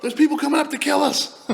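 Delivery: high, loud mock cry of alarm; hammed-up alarm, close to laughing; imitated panicked yell. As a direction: shout the warning way up high. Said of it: "I remember him going".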